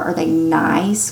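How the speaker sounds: ordinary delivery, nothing marked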